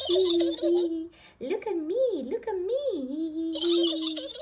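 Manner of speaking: high, loud witchy cackle; witch cackle. Acted. Peppa dressed as a witch, high loud cackling. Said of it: "cackled Peppa".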